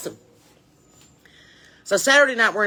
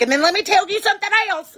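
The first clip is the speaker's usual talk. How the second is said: very high, loud imitation of Mom piling on; mock maternal tirade, pitched way up; scolding mom; 12 semitones higher, 5 dB louder than usual. Mom joining in to scold, high and loud mock fury. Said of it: "And then Mom's like".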